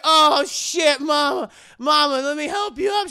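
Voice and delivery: high, loud alarmed cry to mama; panicked shout. Retold or imitated. Daughter yelling to her fallen mama, panicked. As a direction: rush to help a fallen mother, alarmed. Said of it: "And she was like".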